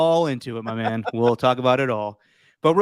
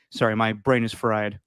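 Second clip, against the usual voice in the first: normal talking voice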